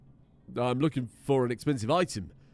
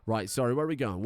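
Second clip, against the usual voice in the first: usual speaking voice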